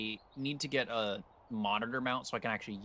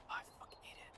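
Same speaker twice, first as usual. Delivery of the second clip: hushed whispered gripe; a sudden whispered curse at the clock; 18 dB quieter, faster than usual. Real, not acted. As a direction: breathe it out under your breath. Sudden muttered complaint.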